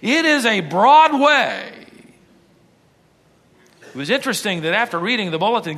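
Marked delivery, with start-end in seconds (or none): 0.0-1.7 preaching. Acted. Preaching at full force.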